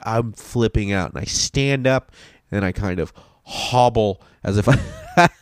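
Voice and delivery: normal talking voice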